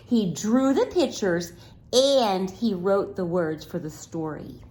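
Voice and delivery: usual speaking voice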